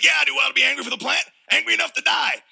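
Jonah shouting high and fast, furious; a sulking prophet shouting at God; angry shout. Retold or imitated. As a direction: yell it back at God in a fast fury. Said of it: "And I said".

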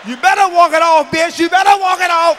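high, very loud yelled warning; mock yelled threat. Comedian retelling yelling at his wife as she walks past, loud and high. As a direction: holler it after her. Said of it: "She gonna walk past me".

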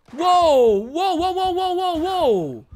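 high, loud run of whoa; real startled cries. Real, not acted. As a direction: jump back in alarm. Surprised by enemy.

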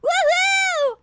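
high, whooped cheer; triumphant whoop. Real, not acted. Player who got the chair, whooping.

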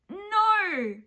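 high, loud drawn-out no; frustrated cry of refusal; exasperated outburst. Real, not acted. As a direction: wail it in loud protest. Outburst while getting angry.

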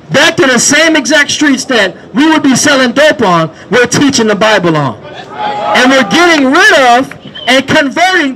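loud, driving sermon shouted to the street; full-force street preaching. Acted. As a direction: preach it out over the crowd.

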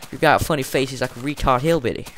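ordinary delivery

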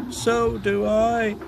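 high, drawn-out queasy groan. A narrator's reading. Mavis billows smoke too, groaning. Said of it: "she groaned".

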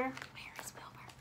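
soft whispered question; very quiet, secretive whisper; hushed wondering. Pretend. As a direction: whisper it as if searching. Wonders aloud to the listeners.

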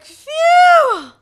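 a huge high, loud shout of relief; huge relieved shout. Retold or imitated. Lost girl shouting with relief when her dad finds her. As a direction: blast out the relief of being found. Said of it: "I was like".

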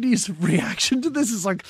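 ordinary talk, nothing marked

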